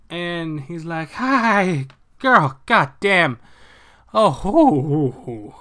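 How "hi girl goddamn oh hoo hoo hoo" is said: louder, slow, panting relief; mock out of breath. Professor after waking Jean, louder panting relief. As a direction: puff it out like you just got a fright.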